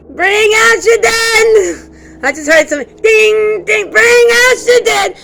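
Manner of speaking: slow, high, loud plague-cart call; Monty Python cart caller. Retold or imitated. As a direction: bellow it like the medieval corpse collector. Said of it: "It's Monty Python I'm talking about".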